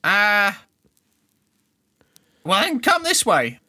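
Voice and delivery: high, loud eager urchin; keen to lead him; street kid voice. Acted. Kids voiced agreeing.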